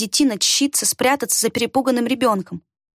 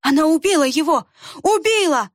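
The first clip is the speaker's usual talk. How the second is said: hysterical wail; 6 semitones higher than usual. A narrator's reading. A villager wails over the fallen boy, high hysterical scream. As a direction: scream it out in grief. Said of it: "истошно заголосил".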